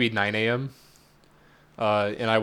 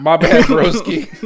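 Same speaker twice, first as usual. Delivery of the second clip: laughing; 7 semitones higher, 7 dB louder than usual. Real, not acted. Apologizing while laughing.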